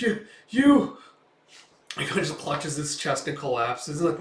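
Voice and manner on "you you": strangled, high gasping words; mock choking gasp. Mayor collapsing from a heart attack, gasping high.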